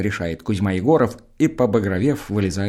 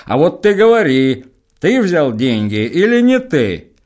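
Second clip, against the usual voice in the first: much higher, louder demand; demanding shout; 8 semitones higher, 9 dB louder, slower than usual. Acted. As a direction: shout him down for an answer. Староста требует ответа. (староста кричит: ты взял деньги?)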